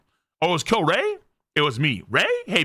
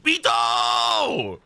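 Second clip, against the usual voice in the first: high, drawn-out mock shout; dramatic name cry. Reacting to the baby's name, hammed-up shout.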